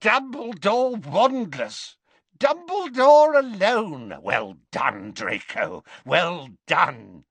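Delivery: high, slow, crowing delight; gloating villain. A narrator's reading. Death Eater congratulating Draco on cornering Dumbledore, gloating. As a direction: crow loudly, then settle into congratulation.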